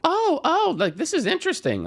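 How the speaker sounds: high, rising delighted "Oh, oh"; showing what real interest sounds like; interested intonation. Shown as a demonstration. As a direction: jump way up in pitch like something caught your interest. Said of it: "You wanna listen for intonation".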